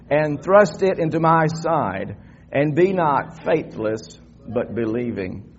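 slow, emphatic Bible reading; congregational scripture reading. Real, not acted. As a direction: read it out slowly for all to follow.

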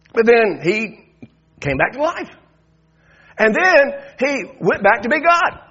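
loud, high fired-up preaching; preaching at full force. Real, not acted. Pastor preaches Easter to the church, loud and fired up. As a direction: drive it home to the congregation.

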